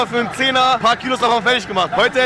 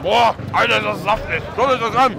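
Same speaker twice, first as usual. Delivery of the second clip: words between bites of wrap; talking while chewing; 2 semitones lower than usual. Real, not acted. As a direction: mouth partly full of food. Seen 3: Holds bitten wrap, speaks right after biting; mouth likely still full.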